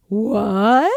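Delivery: loud, rising "what"; mock astonishment. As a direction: play stunned disbelief with a rising question. Acts out reaction to horses upstairs.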